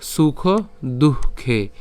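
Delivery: দুঃখে said very slowly with a breathy h after the visarga; visarga pronounced. Shown as a demonstration. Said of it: "এখানে দুঃখেন উচ্চারণ করলাম কারণ এখানে কি আছে, বিসর্গ আছে".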